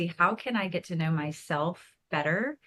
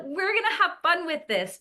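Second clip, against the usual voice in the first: cheerful fun mom; 8 semitones higher, 3 dB louder than usual. Retold or imitated. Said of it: "but as the".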